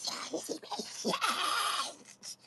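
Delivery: high, slow, ghostly moan of disbelief; eerie wail. Acted. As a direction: stretch it out like a haunted wail. Same line, stretched and strange.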